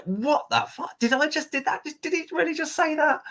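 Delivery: high, stunned disbelief; incredulous, pitch flying up; shocked disbelief. Retold or imitated. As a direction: gasp out your shock at what he said. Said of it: "And I was like".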